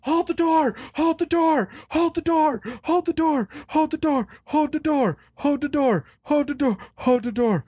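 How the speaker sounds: high pitched frantic repeated yell, fading down; imitating a panicked child screaming, pitch sinking as it goes; mock screaming. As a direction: shriek it over and over like the seizing boy. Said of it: "he starts screaming".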